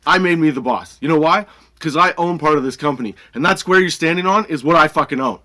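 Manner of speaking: emphatic, defiant re-enactment; assertive comeback. Retold or imitated. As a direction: claim your authority forcefully. Retold face-off with customer.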